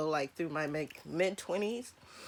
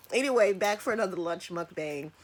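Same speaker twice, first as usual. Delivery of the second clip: usual speaking voice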